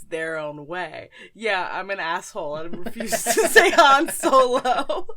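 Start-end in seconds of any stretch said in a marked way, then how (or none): 1.3-5.1 loud laughing through the words; cracking up at your own cheek. Real, not acted. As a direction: laugh out the confession, louder. Laughing at her own snub.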